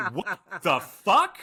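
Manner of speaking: replaying the buddy's shocked outrage; outraged disbelief. Buddy reacting to his parents' fake half-brother prank, slow incredulous outrage.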